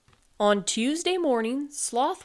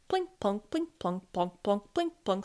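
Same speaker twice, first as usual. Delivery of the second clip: crisp, rhythmic drip sounds; rain-drip words. A narrator's reading. Narrator voices drips landing in pans, rhythmic drip words. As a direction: say each word like a single drop.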